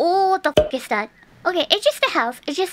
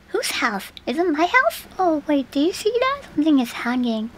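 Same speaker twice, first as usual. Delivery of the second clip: normal talking voice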